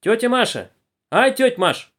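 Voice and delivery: high, loud call. A narrator's reading. Girl calls to Aunt Masha, calling out. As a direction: call out to auntie down the carriage. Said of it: "крикнула одна из девушек".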